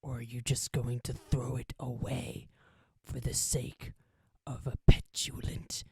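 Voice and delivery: slow, tempting whisper in the ear; a sly little voice coaxing betrayal; tiny whispering voice. Acted. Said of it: "you hear a tiny little voice in your ear".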